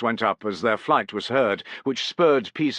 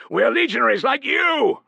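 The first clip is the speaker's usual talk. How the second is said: much higher, loud roar; 6 dB louder than usual. A narrator's reading. Piso roaring at the sentry to hold fire. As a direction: roar it across the dark. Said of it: "roared Piso".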